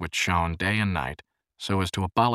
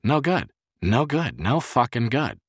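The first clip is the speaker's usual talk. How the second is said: high, insistent, bitter repetition; bitter self-talk, pitched up; 7 semitones higher, 4 dB louder than usual. A narrator's reading. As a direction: hammer it out bitterly. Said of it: "he asked himself bitterly".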